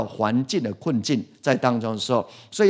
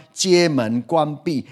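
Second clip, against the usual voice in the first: louder, slowed, each word weighted; preaching the verse out slow; slow emphatic reading; 5 semitones higher, 5 dB louder, slower than usual. Acted. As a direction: lean on the verse slowly.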